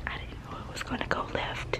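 hushed whisper to the camera. Real, not acted. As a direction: whisper it so he can't hear. Prank gone wrong aside.

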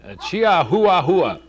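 "Chihuahua hua" with an extra "hua" tacked on; joke pronunciation. Pretend. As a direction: say "Chihuahua hua", slowly doubling the last syllable. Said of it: "I call him Chihuahua hua".

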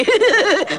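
very high henchman's giggle; dopey giggle. Acted. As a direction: giggle high like a simple sidekick. Henchman laughing about his boss.

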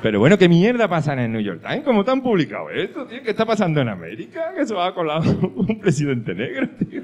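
loud, high comic indignation; mock outrage. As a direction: rant in disbelief, half laughing. Se indigna leyendo la columna absurda. (indignación fingida, a voces)

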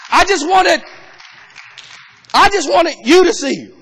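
loud, fervent preaching build. Acted. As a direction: preach it with rising fire. Preaching at full force.

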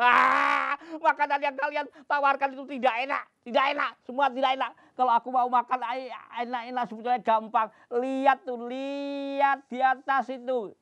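high, loud, fast tearful whine; whiny crying child. Acted. Gundul refusing all the food, high tearful whine. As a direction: complain like a spoiled crying kid.

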